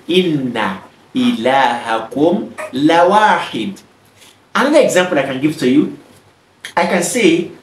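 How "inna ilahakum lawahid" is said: lawahid drawn out, the la clearly joined; slow Arabic recital. Shown as a demonstration. Teacher showing where the lam goes, slow. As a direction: model it slowly for learners. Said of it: "that is why we say".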